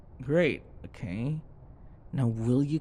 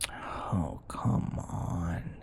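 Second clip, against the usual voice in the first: whispered scoff; 5 semitones lower, 5 dB quieter, much slower than usual. Acted. Brushing off partner's worry.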